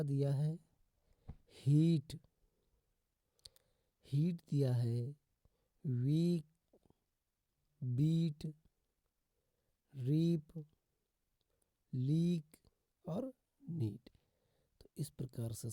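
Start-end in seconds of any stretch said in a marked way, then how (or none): 1.6-2.2 "हीट" loud with a long ee; teacher punching out "हीट" with long ee; long EE pronunciation. Shown as a demonstration. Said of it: "ईए का उच्चारण बड़ी ई से". 5.9-14.1 "वीक, बीट, रीप, लीक, नीट" one by one with long ee; spaced word list stressing long ee, as in "बीट"; long EE pronunciation. Shown as a demonstration. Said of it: "ईए का उच्चारण बड़ी ई से".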